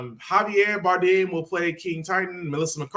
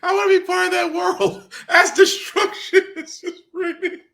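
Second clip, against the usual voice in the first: half-sung line, laughing; giggling through the lyric; 5 dB louder than usual. Real, not acted. Laughing while quoting the song.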